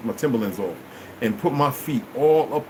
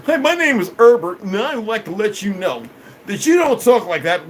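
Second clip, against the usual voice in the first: mock nerdy character; 6 semitones higher, 4 dB louder than usual. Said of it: "put tape around them".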